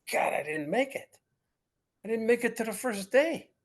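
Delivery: hammed-up disappointment, pitch climbing; retold dismay. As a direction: relive the letdown with a rising wail. Thinks he missed the draft.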